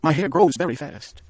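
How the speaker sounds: high and loud, pleading to be forgiven; wife begging husband not to be upset; anxious plea. A narrator's reading. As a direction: rush it out hoping he'll accept it. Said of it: "she cried".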